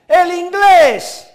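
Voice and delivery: "El inglés" boomed out slow and high; big, slow reveal on "inglés"; emphatic announcement. Real, not acted. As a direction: proclaim "El inglés" loudly, drawing it out. Answers his own question emphatically.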